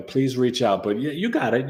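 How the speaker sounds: normal talking voice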